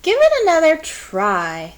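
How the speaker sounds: put-on cheerful old woman urging; coaxing grandma. A narrator's reading. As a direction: nudge the sulking kid to try again.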